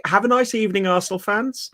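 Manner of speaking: imitating the steward's cheery farewell; mocking the steward's greeting. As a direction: lilt it like a smug steward waving fans off.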